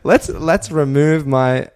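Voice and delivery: loud, high, through laughter; laughing hype. Real, not acted. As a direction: whoop it up while laughing. Laughing about being on edge.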